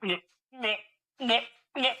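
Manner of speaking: high mock retching, bleh bleh; imitated retching noises. Friend in the car hears her retch before she throws up. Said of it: "all you heard was".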